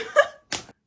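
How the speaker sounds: loud breathy laugh; hearty laugh. Real, not acted. Cracking up after telling viewers about the cheating, loud laugh. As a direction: crack up at the memory.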